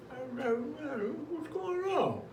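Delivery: high, soft, drawn-out confusion; acted bewilderment. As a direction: play alarmed bewilderment, pitch way up, voice small. Acting out sudden face symptoms.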